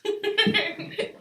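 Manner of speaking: loud, high burst of laughter; real bubbling giggle; laughing. Real, not acted. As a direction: crack up briefly at your own slip. Laughs after botching company name.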